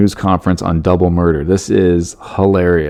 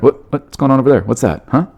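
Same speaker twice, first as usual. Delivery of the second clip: mock startled cat officer, higher; distracted cat-cop; 5 semitones higher, faster than usual. Imagined sergeant at the briefing, mock distracted cat. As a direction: play a cat spotting yarn. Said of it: "she started going".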